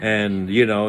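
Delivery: ordinary delivery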